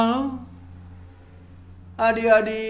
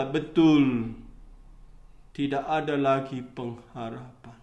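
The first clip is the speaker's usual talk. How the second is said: low, soft, final verdict; grave Abraham; 11 semitones lower, 8 dB quieter than usual. Acted. Abraham tells the rich man all hope is gone, low and grave. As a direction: lower and soften the voice for Abraham. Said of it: "Bapak Abraham berkata".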